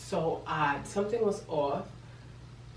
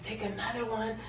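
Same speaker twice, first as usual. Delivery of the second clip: higher, softer disbelieving repeat; quiet, pitched-up mock astonishment; incredulous echo; 5 semitones higher, 7 dB quieter than usual. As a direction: hand the words back in disbelief. Incredulous echo of boyfriend's advice.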